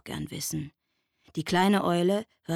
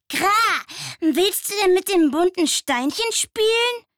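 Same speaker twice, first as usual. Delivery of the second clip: much higher, louder bird voice with a caw; cawing raven; 13 semitones higher, 8 dB louder than usual. A narrator's reading. Raven offering the owl a pebble, high cawing voice. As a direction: croak it out like a chatty raven. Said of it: "ein Rabe ... und fragte".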